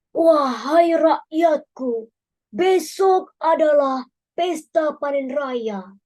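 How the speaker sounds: slow, commanding king's voice; royal decree. Acted. The bee king commands his people, slow and regal. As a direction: proclaim it like a wise king. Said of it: "titah sang raja".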